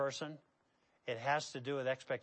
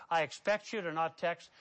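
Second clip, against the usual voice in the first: ordinary talk, nothing marked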